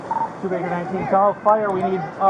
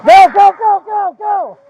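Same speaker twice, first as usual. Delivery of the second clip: urgent shout; 6 semitones higher, 5 dB louder than usual. Real, not acted. Hurrying rescue.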